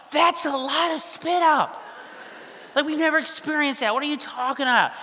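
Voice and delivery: high-pitched, hammed-up incredulity; mock disbelief. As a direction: protest to mom in overplayed alarm. Panicked dad disputes mom's calm answer.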